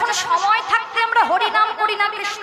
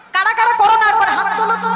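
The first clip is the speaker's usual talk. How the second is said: calling out; 3 semitones higher, 5 dB louder, faster than usual. Real, not acted. Calling out to the audience.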